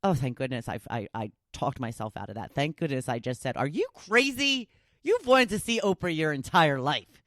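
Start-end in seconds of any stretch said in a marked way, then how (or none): 3.6-7.2 higher-pitched mock disbelief at oneself; lightly acted talking-to, pitched up; incredulous self-scolding. Talking herself into buying tickets, mock-incredulous scolding.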